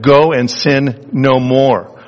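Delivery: each word laid down slowly; slow, weighty words of Jesus; slow solemn quote. Acted. Jesus to the adulteress, slow and solemn.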